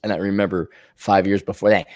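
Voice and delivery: usual speaking voice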